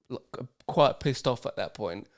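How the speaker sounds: ordinary talk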